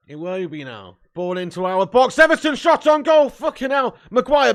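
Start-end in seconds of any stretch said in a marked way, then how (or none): 1.1-4.5 loud high alarmed shout at the shot. Real, not acted. Fan watching an Everton shot, alarmed loud yell. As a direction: panic as the shot comes in.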